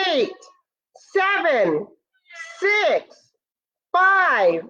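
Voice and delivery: high, eager countdown to liftoff; excited countdown. Pretend. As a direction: count down like a rocket launch for the kids.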